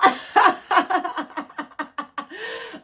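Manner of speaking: loud, high giggling laugh; real high laugh; high burst of laughter. Real, not acted. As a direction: crack up at the card. Laughs at the reading.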